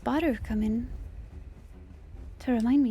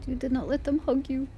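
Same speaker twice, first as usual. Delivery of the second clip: ordinary delivery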